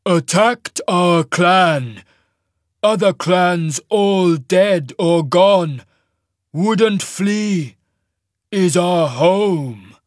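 very slow, booming giant; avalanche-voiced giant. A narrator's reading. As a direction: boom each word out, loud and drawn out. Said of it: "in a voice like an avalanche".

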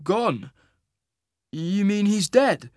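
high, hesitant question; taken aback. Acted. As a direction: ask it slowly in disbelief. Hero reacts to news of loss.

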